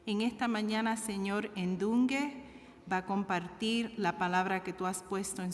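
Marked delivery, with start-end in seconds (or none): none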